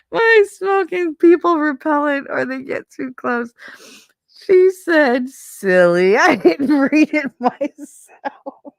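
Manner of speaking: high, loud, giggling through the words; cracking up mid-sentence; laughing reading a comment. Real, not acted. Laughing while reading funny comment.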